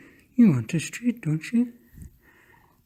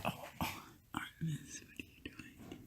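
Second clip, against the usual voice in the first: whisper; 22 dB quieter than usual. Real, not acted. Whispering to the hidden cat.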